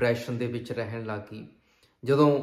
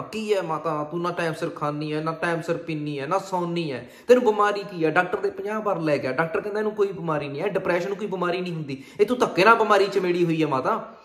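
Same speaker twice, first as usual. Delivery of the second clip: raised, higher voice, rattling off complaints; acted impatient son snapping loudly; loud, irritated scolding; 5 semitones higher, 6 dB louder than usual. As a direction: scold your mother in exasperation. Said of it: "Manjeet ਇੰਨਾ ਉੱਚੀ ਬੋਲਦਾ".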